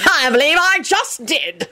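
slow, high, haughty reply; lofty self-satisfaction. A narrator's reading. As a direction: sniff it with disdain, drawn out. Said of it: "he sniffed haughtily".

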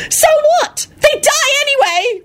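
very high despairing shriek. A narrator's reading. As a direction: shriek back hopelessly. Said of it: "Rosie shrieked".

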